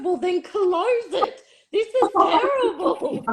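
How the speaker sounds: high, slow laughing plea; laughing protest. Retold or imitated. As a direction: cry it out through laughter. Retold panicked reply, laughing.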